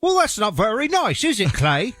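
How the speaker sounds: high-pitched, put-on British reproach; mock British accent. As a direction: play a prim Brit in a British accent. Mock British retort after British-accent talk.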